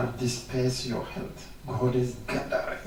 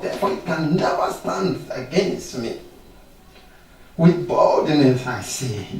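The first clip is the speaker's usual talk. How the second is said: louder, higher fervent declarations; preaching at full force; 4 semitones higher, 5 dB louder than usual. Acted. Preacher leading bold confession against sickness, fervent. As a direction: proclaim each line like a victory.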